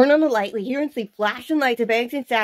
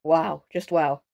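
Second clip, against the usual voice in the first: ordinary delivery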